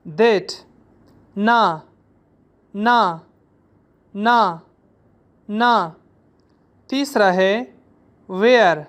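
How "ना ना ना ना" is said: teacher drilling a syllable; Chinese word demo. Shown as a demonstration. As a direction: model ना for the class. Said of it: "दूसरा है that".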